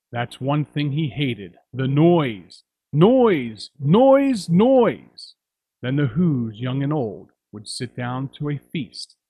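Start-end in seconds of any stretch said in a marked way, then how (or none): 1.7-5.3 slow and loud, building to a high, loud peak; building exasperated noise; mounting exasperation. A narrator's reading. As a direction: pile on the irritation with each noise. Grinch recalls hated noise.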